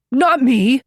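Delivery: high, loud panicked cry; terrified cry. Acted. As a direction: scream it in pure terror. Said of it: "he cried".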